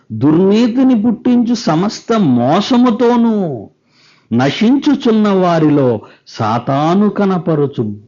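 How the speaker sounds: drawn-out, high-pitched Bible reading; declaimed scripture reading. Acted. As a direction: intone the verse phrase by phrase. Reads the scripture aloud to congregation.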